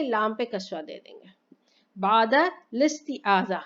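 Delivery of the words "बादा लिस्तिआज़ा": slow, careful बादा लिस्तिआज़ा with kasra on lam, joined; teacher models बादा लिस्तिआज़ा for students, careful and deliberate; correct Arabic reading. Shown as a demonstration. Teacher reads बादा लिस्तिआज़ा joined to students, slow and deliberate. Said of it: "ये ऐसे पढ़ा जाता है".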